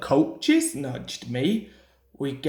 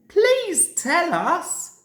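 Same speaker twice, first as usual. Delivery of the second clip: pleading engines; 12 semitones higher, 4 dB louder, slower than usual. A narrator's reading. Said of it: "said all the engines".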